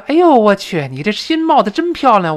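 high, loud delighted exclamation; mock delight; admiring. Shown as a demonstration. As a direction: gush with delight. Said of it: "表示是赞赏".

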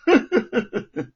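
a loud, high gloating villain laugh; 得意大笑. Acted. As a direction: laugh out your triumph over him. Luo triumphs after his order. (罗万金得意洋洋的大笑)

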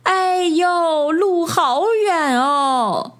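high, drawn-out cry of dismay; long high-pitched bird exclamation; startled little bird. A narrator's reading. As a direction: cry out in surprise, stretching the words. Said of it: "小鸟惊叫一声".

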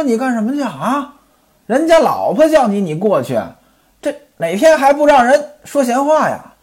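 音调抬高、带着醋意地质问; 拦住丈夫，尖着嗓子盘问; 质问丈夫的妻子. Acted. 妻子质问丈夫去邻家. (吴月娘醋意十足地高声质问西门庆)

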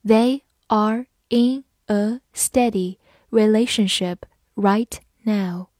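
word by word, with clear gaps between words; slow careful reading. Shown as a demonstration. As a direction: read slowly, each word set apart. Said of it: "好，慢慢来读".

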